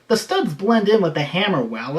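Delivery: ordinary talk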